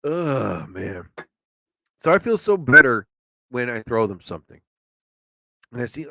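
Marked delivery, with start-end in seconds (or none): 0.0-1.1 sad groan. Real, not acted. Feeling bad for hungry squirrels.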